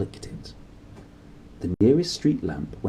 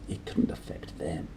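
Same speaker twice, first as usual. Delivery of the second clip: soft unvoiced whisper; 4 semitones lower, 9 dB quieter than usual. A narrator's reading. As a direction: barely breathe it. Hushed sleepy thought.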